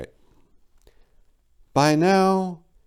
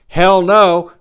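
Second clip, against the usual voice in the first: loud, high burst of Hell no; exasperated shout; 11 semitones higher, 12 dB louder than usual. Acted. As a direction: bark out Hell no in disbelief. Heated rant at the bird.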